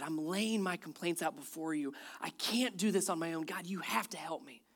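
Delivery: soft, humble prayer to God; humble plea. Acted. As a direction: plead quietly and reverently. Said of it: "David came before God humbly".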